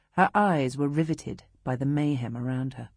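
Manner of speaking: ordinary delivery, nothing marked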